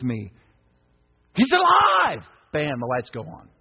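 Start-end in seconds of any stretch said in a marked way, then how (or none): 1.4-2.2 very high, loud triumphant cry; exultant shout. Real, not acted. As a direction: burst out with joy. Preacher's exultant climax.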